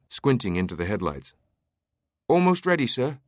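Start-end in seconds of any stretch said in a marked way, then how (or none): 2.3-3.2 put-on high, called-out British accent. A narrator's reading. Pilot calling to his employer, British accent. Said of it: "he called in a British accent".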